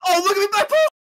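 high loud yelp; panicked cry. Real, not acted. Panic over phone.

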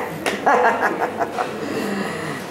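long falling laugh. Acted. Laughs after the retold speech.

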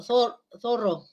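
Zor-, zorro drawn out slowly with a th onset; slow interdental demo. Shown as a demonstration. Teacher slowly modelling zorro for the class. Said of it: "La D debilitada es fricativa interdental".

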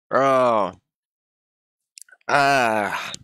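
drawn-out moans from the burn; pained groan. Real, not acted. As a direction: moan as your mouth burns. Burning mouth from the hot chip.

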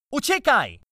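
high, loud warning cry; urgent shout. A narrator's reading. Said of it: "krzyknąłem".

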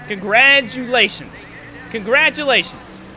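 sarcastic congratulations. Real, not acted. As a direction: drag it out mockingly. Sarcastic at losing places.

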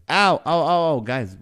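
high loud yelps of ow; ow cries. Real, not acted. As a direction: yelp ow in mock pain. Mock pain at host's joke.

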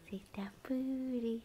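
slow soft playful whisper. Real, not acted.

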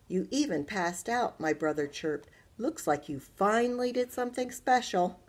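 put-on lively, loud read-aloud for children; bright storyteller voice; story narration. A narrator's reading. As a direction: read it to a class with animation. Teacher reads story aloud.

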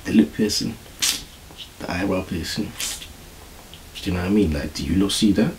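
quiet, low, half-whispered quote; hushed imitation. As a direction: murmur it like a secret studio talk. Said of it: "He said".

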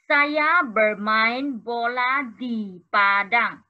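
very slow Malay sentence for the class; slow clear reading. Shown as a demonstration. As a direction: stretch each word for beginners. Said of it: "第六题是".

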